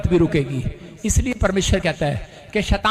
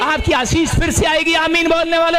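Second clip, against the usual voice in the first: fervent preaching; 8 semitones higher than usual. Real, not acted. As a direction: preach it with rising fervor. Preaching at full force. (जोशीला प्रवचन)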